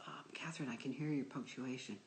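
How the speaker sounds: softly whispered gentle director. Retold or imitated. A gentle director giving a note to his actress, hushed. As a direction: take her aside, hushed and kind. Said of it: "gentle director that he is".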